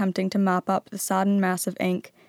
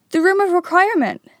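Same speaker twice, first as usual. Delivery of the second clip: excited realization; 11 semitones higher, 7 dB louder than usual. A narrator's reading.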